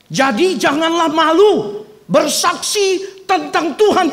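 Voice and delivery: slow, loud, high solemn reading; proclaimed scripture. Acted. Pastor reading Timothy to the congregation, loud solemn proclamation. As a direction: declaim each word of the verse.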